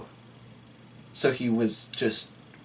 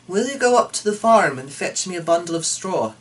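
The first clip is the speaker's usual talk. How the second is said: higher, louder motherly request; a mother begging her idle son; pleading mother; 7 semitones higher, 5 dB louder, faster than usual. Acted. Mother asks son in story.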